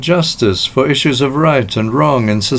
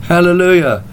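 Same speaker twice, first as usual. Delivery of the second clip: higher-pitched, mildly raised exclamation; retold shout; 7 semitones higher than usual. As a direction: call it out as a restrained imitation of a joyful shout.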